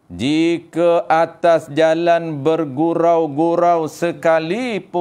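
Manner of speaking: "Jika atas jalan bergurau-gurau sekalipun" slow, loud and intoned; emphatic kitab recitation with a long held "pun" in "sekalipun"; Tok Guru's emphasis. Shown as a demonstration. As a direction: press every word and stretch "sekalipun". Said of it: "Dia tekan tu".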